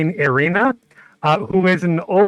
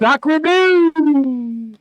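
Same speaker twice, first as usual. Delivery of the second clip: hammed-up French accent, higher; French exclamation; 8 semitones higher than usual. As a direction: blurt it with a comic French accent. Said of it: "slightly offensive French accent".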